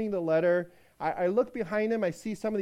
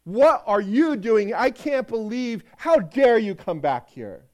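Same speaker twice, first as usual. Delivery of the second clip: loud, slow, outraged scolding; loud slow indignant tirade; angry outburst; 3 semitones higher, 9 dB louder than usual. Acted. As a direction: let him have it, furious. Master confronts returning runaway slave.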